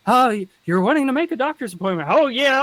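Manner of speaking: high, quick Southern phone voice; Southern accent. Acted. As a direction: lay on a lively Southern accent. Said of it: "with the Southern accent".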